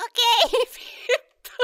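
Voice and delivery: breaking up with laughter; laughing. Real, not acted. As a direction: talk through real laughter. Laughing at Squirtle swearing.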